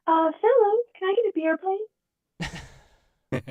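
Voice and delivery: high-pitched, sweetly pleading druid; sweetest druid voice. Acted. Druid cries out to enemy men for beer, sweet high plea. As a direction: ask nicely in a high voice, softening at the end. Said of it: "in my sweetest druid voice".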